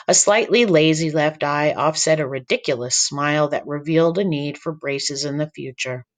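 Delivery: plain reading voice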